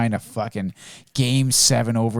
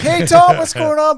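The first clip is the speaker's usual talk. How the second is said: cheery greeting; 5 dB louder, slower than usual. Retold or imitated. Greeting the kid.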